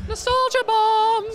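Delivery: high, drawn-out exclamation; long high-pitched interjection; mock announcement. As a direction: sing it out high and slow, like dropping a catchphrase.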